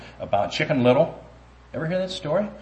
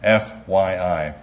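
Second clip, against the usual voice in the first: letter by letter; much slower than usual. Shown as a demonstration. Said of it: "For your information".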